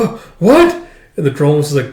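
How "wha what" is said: high, loud, startled stammer; shocked exclamation. Acted. Fighter startled by cracks in the ground, shocked high cry. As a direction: blurt it out in shock. Stunned by the attack's result.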